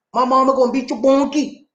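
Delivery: high Gullah threat through laughter; laughing mock Gullah kid's threat; Gullah accent. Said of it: "He said".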